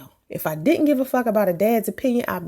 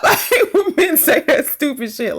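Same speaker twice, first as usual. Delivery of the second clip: laughing through the complaint; 3 semitones higher than usual. Real, not acted. Venting about men, said through laughter. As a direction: say it while still laughing.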